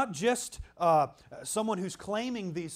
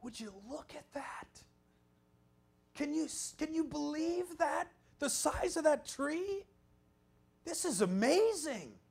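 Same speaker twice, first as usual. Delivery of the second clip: hushed whisper, then rising higher in wonder; awed at the sequoias; 10 semitones higher than usual. Retold or imitated. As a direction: retell gaping up at a giant tree. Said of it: "I kept saying".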